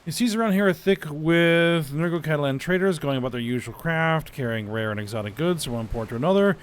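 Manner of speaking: lively, fast dramatic reading; dramatic event reading. Acted. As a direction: read it like an adventure tale. Reads game event text aloud.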